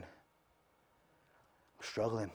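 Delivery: sad confession. Retold or imitated. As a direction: let the truth drag out quietly. Honest confession to brother.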